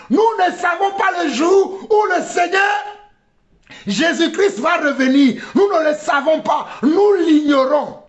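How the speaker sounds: high, ringing preacher's shout; urgent high-pitched exhortation; impassioned preaching. Real, not acted. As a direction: proclaim the verse to the congregation. Preaches at full force.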